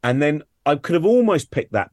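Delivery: usual speaking voice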